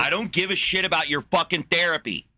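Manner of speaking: high, loud angry snap. Real, not acted. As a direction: cut him off in disgust. Snapping at friend about therapy.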